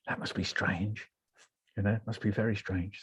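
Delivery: soft, low inward thought; musing aside. Retold or imitated. Patient reflecting on nurses' letters, hushed musing. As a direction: drop right down as if thinking privately.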